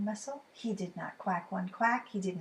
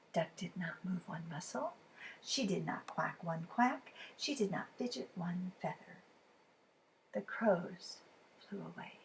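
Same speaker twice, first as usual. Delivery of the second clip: hushed narration; 2 semitones lower, 9 dB quieter than usual. A narrator's reading. Narrator hushing as Duck freezes, hushed and soft. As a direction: hold your breath and keep it quiet.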